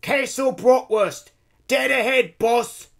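high, loud lookout's shout; calling out. A narrator's reading. Engineer alerting the conductress to the castle, calling out. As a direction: yell the sighting to the boss. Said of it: "The engineer calls out".

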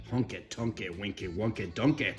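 usual speaking voice

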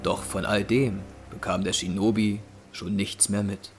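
ordinary talk

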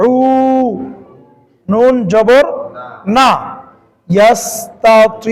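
slow, deliberate recitation; slow reading demo. Shown as a demonstration. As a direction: sound the word out slowly. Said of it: "একটা পড়া হচ্ছে".

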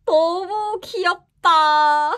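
gasp, then a high, loud, slow squeal; excited squeal. Real, not acted. Fan opening an album, squealing over a photocard. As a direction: melt over the cuteness, drawn out.

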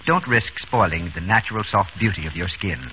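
usual speaking voice

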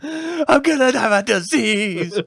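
slow, high, drawn-out mock whine; mock dread. Joking to his friend about needles, mock dread. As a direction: ham up the horror of catching something.

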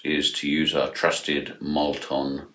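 ordinary delivery

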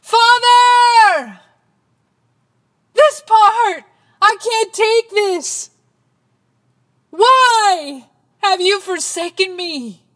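high, full-force cry; screamed in anguish. Acted. Jesus crying to his Father from the cross, screaming. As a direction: scream it out loud, high-pitched. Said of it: "it says he screamed".

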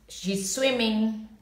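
usual speaking voice

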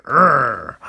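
loud, short mock growl; angry cartoon growl. Cartoonist adding angry lines to stick man, mock growl. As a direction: growl out the stick man's anger.

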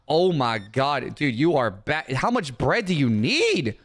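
ordinary talk

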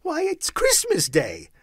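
high, loud, amazed answer; astonished high-voiced lad; boy's wonder. A narrator's reading. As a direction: call up as if it's obvious. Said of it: "with all his might of wonder".

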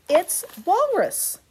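put-on protesting child voice.

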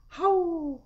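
high, loud drawn-out howl; long howling call; imitated howl. Shown as a demonstration. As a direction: howl the word, pitched right up. Example of a howl sound.

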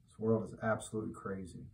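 quick, soft muttered repeat with "world" stressed; quiet aside. Shown as a demonstration. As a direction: drop it under your breath, quickly. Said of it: "repeated louder".

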